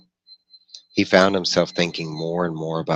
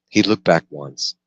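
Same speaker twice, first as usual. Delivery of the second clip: ordinary delivery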